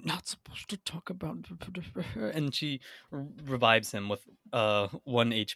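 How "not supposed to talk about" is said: urgent hushed whisper. Acted. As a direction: hiss it so no one hears. Jessica hushes the man.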